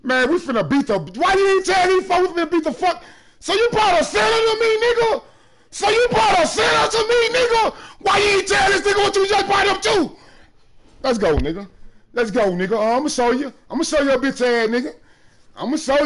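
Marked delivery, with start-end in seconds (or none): 3.4-10.2 shouting down the opponent over a basket; trash-talk shout. Real, not acted. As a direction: yell it right in his face. Trash talking opponent in game.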